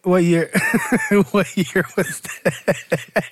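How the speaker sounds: helpless laughter through the question; cracking up. Real, not acted. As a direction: laugh so hard the words stretch out. Cracks up at a wild claim.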